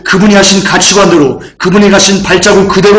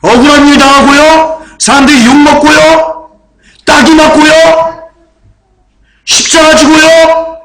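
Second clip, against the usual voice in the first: fiery preaching; 9 semitones higher than usual. Acted. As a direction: preach it to the rafters, slowing each phrase. Preaching at full force.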